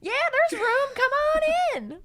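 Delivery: very high, bright welcoming elevator voice; sing-song hostess inviting people in; Midwestern chirpy greeter. Retold or imitated. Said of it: "Talk about Midwestern".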